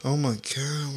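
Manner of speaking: normal talking voice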